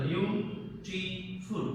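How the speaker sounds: Beautiful drawn out in three separate beats; broken into syllables. Shown as a demonstration. Teacher counting three syllables, beautiful split slowly into parts. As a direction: say beautiful slowly, a gap between each syllable. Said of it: "ভেঙে ভেঙে উচ্চারণ করতে".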